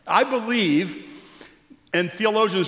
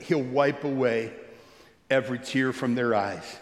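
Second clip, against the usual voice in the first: tender scripture reading; 6 semitones lower, 3 dB quieter than usual. Acted.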